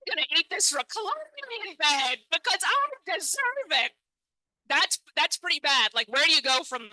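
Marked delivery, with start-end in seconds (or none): 0.1-3.9 smug sleepwalker self. Retold or imitated. Sleepwalking comic tells the audience her smug excuse. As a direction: coo it like a treat you earned. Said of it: "took the melon out and said".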